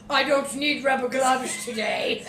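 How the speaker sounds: slow, high, laughing tough voice; mock sergeant. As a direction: play a cocky sergeant. Playing a sergeant.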